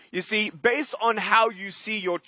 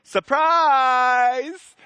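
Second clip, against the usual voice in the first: surprise; 7 semitones higher, 5 dB louder than usual. Retold or imitated. Baby announcing himself at birth.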